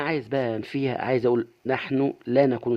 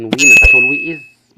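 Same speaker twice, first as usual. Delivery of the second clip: emphatic loud correction; 14 dB louder than usual. Shown as a demonstration. Teacher stresses a grammar error.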